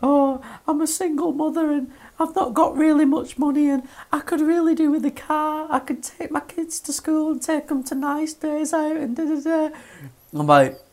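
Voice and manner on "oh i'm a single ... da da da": much higher, far off pleading woman voice; high mock voice of a pleading mother; pleading single mother. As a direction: mimic a begging caller in a high voice. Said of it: "and she was like".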